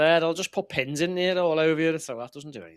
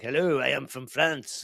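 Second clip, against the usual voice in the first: slow put-on French accent; mock a footballer faking French-accented English; drawn-out hammed-up French English; Joey Bart, French accent; slower than usual. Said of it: "went to France and he was like".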